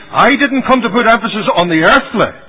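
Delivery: high, loud preaching denial; vehement rejection. Retold or imitated. Christ refusing the earthly before the people, vehement. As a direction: drive it out at full force.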